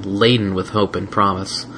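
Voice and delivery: plain reading voice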